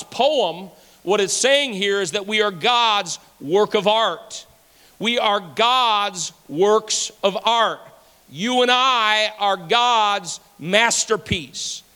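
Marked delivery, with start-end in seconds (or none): none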